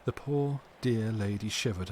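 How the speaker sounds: ordinary delivery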